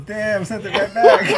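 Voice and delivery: laughing out loud in surprise; cracking up, high and loud. Real, not acted. Reacting to fight on screen.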